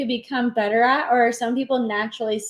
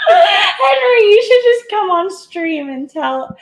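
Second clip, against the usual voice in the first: calling out; 11 semitones higher, 10 dB louder, slower than usual. Real, not acted. Calling out to producer off-mic.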